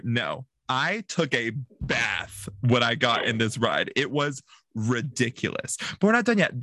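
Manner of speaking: usual speaking voice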